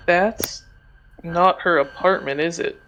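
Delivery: ordinary talk, nothing marked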